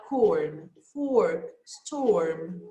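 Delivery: "corn", "fork", "storm" with the R said lightly, slightly; light R model. Shown as a demonstration. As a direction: let the R fade. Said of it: "you can pronounce it light".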